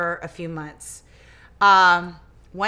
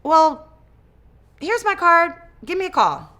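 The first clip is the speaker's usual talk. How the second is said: confident lawyer voice; 9 semitones higher, 8 dB louder than usual. Retold or imitated. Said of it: "And he said".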